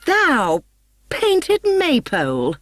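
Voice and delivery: high, slow shrieked insult; raging little woman insulting rival; furious retort. A narrator's reading. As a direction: screech it at the tall rival.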